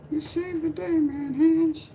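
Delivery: very high falsetto, gushing thanks; grateful lady. Pretend. Lady thanking her rescuer, very high falsetto. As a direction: swoon up high in gratitude.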